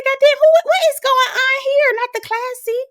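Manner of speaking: high, loud put-on disbelief; mock shock. Telling viewers her reaction to the flashback, mock shock. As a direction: exclaim it way up high, acting scandalized.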